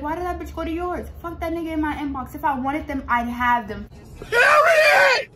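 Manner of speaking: high, loud furious comeback; angry shout. Retold or imitated. Firing back at her ex, loud and high. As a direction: yell it back at him. Angry reply, quoted text.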